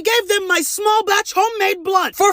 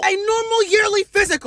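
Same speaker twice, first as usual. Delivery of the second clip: normal talking voice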